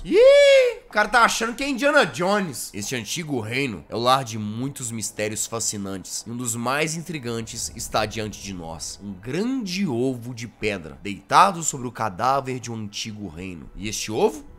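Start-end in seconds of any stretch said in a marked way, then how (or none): 2.7-14.5 Quirrell voice. Acted. Quirrell meeting the knight, low soft mysterious voice. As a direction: murmur like a polite curious explorer.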